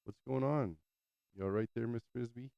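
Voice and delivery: high, soft cooing to a pet; pet talk. Real, not acted. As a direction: coax the little rat gently. Talking to pet rat.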